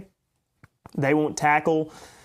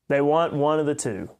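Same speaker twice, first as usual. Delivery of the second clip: ordinary talk, nothing marked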